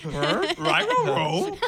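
high, loud cartoon-dog mimicry; Scooby. Teasing a co-host's odd answer, cartoon-dog voice. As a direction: echo it like a laughing cartoon dog. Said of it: "Why did you say it like you were, like, Scooby?".

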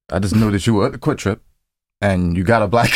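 usual speaking voice

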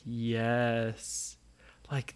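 low, small turtle voice; a tiny creature's low yes; lightly voiced turtle; little turtle voice. Turtle answers Nora.

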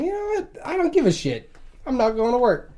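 high, squeaky defiance that eases down; mock defiant rant. Telling the boss off about work, high-pitched and defiant. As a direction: pitch up like a petulant kid, then settle. Pissed-off retold self quote.

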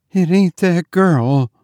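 high, frightened maid's voice; gasped. A narrator's reading. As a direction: gasp out the denial in fear. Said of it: "gasped Cordelia".

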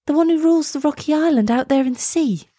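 put-on high, astonished girl's question; girl's high-pitched disbelief; gasped. A narrator's reading. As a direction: ask in amazed alarm. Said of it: "Elsa gasped".

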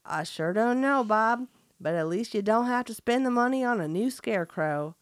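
folksy Southern farmer voice; hammed-up country farmer; best country accent. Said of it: "That was my best country accent".

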